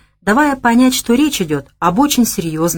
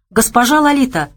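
higher, lively exclamation; exclaimed greeting; 7 semitones higher than usual. A narrator's reading. Bogomolov hurrying to greet the club hostess, exclaiming. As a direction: call it out as a delighted hail. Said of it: "воскликнул Богомолов".

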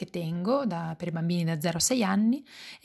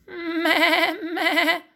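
high, quavering goat bleat; belato di capra; 9 semitones higher, 4 dB louder than usual. A narrator's reading. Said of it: "protesta la signora Gina". (belato acuto e tremolante di protesta)

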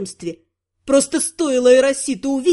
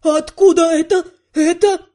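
loud indignation; 3 semitones higher, 3 dB louder than usual. A narrator's reading. Couturier scandalised by the heroine's outfit, loudly indignant. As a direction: demand answers, scandalised. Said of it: "И уже громко, возмущённо".

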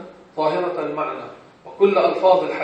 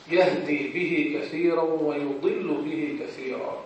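Quran recitation; 2 semitones lower, 7 dB quieter, slower than usual. Acted. Quoting a Quran verse.

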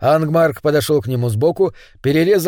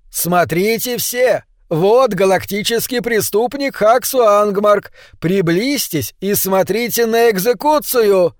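higher, slow, half-sung yell; loud yelling; 6 semitones higher, slower than usual. A narrator's reading. Angmark yelling to the crowd to watch, slow and higher. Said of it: "Ангмарк громко орал".

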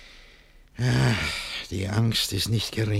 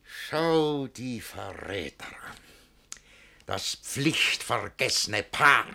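cold menace; slower than usual. Acted. Master eyeing his treacherous servants, slow and menacing. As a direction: let the contempt drip slowly.

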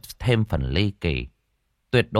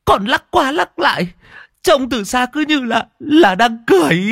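put-on frightened boy; 6 dB louder than usual. Servant boy describing the corpse.

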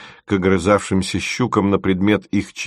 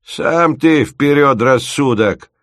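громче и выше, обиженно; надуйся и огрызнись, как обиженный дед; напыжившись; 6 semitones higher, 4 dB louder, slower than usual. A narrator's reading. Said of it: "напыжился Мамонт". (дед обиженно отбривает умника-студента)

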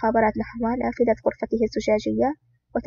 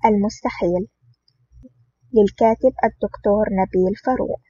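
a louder, bold announcer read of the title and blurb; title announcement; 3 dB louder than usual. Acted. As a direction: introduce the book like a trailer voice. (إعلان العنوان بصوت مذيع قوي)

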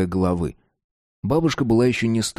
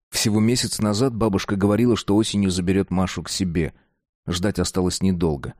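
ordinary delivery, nothing marked

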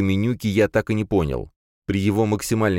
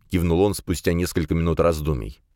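usual speaking voice

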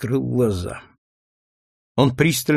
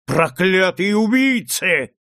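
slow, higher, raging yell; loud yell; 5 semitones higher, slower than usual. A narrator's reading. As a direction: scream the curse out a window. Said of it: "громко завопил он".

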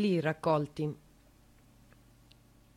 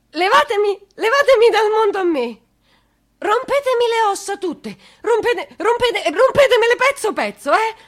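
high, loud desperate cry; desperate scream; 12 semitones higher, 11 dB louder than usual. Acted. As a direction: hurl it out begging to be broken instead. Mother screams her plea. (urlo disperato, acuto e forte)

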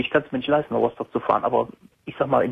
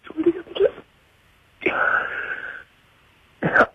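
tearful, broken voice between sobs; crying; 3 semitones higher than usual. Real, not acted. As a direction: speak through real tears, voice cracking. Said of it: "Weinst du?".

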